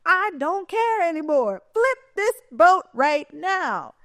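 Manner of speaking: high, slow defiant outburst; imitating Lieutenant Dan. Lieutenant Dan challenging the storm, slow angry cry. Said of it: "but this man is angry".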